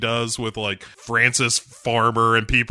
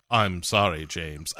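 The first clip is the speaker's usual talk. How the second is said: lower, trailing off sorry; sheepish apology; 8 semitones lower, 6 dB quieter than usual. Pretend. M apologizing to Bond, soft sheepish mock. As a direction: apologize meekly then fade out. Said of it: "She gives him the old".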